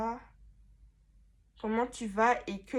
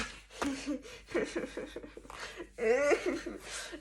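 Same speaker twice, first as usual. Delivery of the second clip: long high giggle; helpless run of laughter; giggling fit; 4 semitones higher, 3 dB quieter than usual. Real, not acted. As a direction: crack up mid-take. Cracks up repeating her line.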